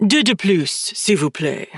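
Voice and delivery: put-on a French accent laid on thick for show; overdone French accent. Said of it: "purrs in an overly emphasized French accent".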